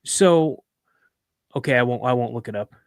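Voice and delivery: usual speaking voice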